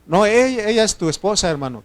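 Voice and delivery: mock glib insistence, starting high and loud; high-pitched opening, dropping off quickly; flippant imitation. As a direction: imitate a careless church brother blurting it out. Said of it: "son bien livianos y dicen".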